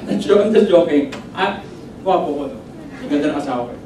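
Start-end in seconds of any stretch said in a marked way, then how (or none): none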